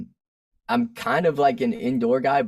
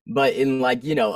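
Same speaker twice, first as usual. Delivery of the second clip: usual speaking voice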